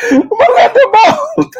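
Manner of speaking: high squeal through laughter; laughing. Real, not acted. Laughing mockery of both answer.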